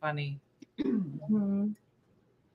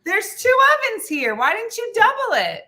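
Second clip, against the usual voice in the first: higher, louder exasperated questions; 8 semitones higher, 4 dB louder than usual. Real, not acted. Host to Diane about the ovens, exasperated. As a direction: scold her in disbelief.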